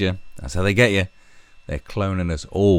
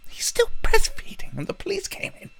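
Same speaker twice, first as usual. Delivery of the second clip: mock whisper. Parodying the mum's statement.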